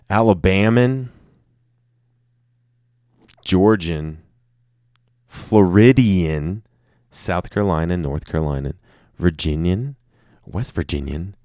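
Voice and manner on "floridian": ordinary talk, nothing marked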